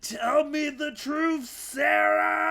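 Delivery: slow, high and shouted; angry demand. Acted. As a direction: bellow the demand at her. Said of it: "and you're saying".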